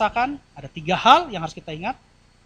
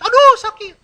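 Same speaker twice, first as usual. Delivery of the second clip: mock pain cry; 14 semitones higher, 12 dB louder than usual. Acting being pinched.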